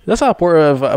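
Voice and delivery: ordinary talk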